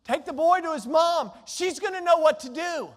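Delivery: loud, urgent order to a servant; calling out. Acted. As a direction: call it across the field, loud and firm. Father calls out to servant.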